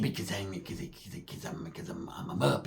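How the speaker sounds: breathy whisper, stuttering; whispered stammer. Retold or imitated. Cousin explaining his fast talk, whispered stammer. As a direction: whisper it, tongue-tied. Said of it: "he said".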